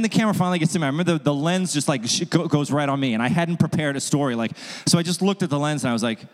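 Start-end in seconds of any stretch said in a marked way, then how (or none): none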